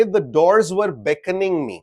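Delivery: very slow, words drawn out; slow example. Shown as a demonstration. Teacher giving the class a personification example, slowly. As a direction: read the sentence out slowly as an example. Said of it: "if I were to say".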